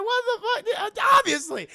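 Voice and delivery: high, loud comic disbelief; mock outburst. Van Helsing reacts to the mirror, loud hammed-up outburst. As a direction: splutter it loudly in mock shock. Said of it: "He's like".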